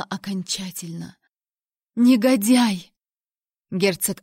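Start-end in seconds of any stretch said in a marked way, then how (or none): 1.9-2.9 loud, higher burst of indignation; accusing shout; outraged exclamation. A narrator's reading. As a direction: cry out the insult in outrage. Heroine accuses the duke.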